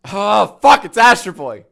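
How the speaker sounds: loud, high surprised outburst. Real, not acted. Streamer sees Astro Boy on screen, loud surprise. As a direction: blurt it out startled.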